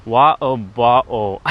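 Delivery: wa'o ba'o with glottal stops; British glottal stops. Shown as a demonstration. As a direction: swallow the T's British style. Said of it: "Let's see".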